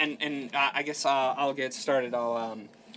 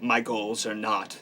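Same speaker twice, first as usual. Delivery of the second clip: slightly lower, halting solemn delivery; weird serious character; 4 semitones lower than usual. Acted. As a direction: state terms gravely, with long pauses. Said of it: "weird serious".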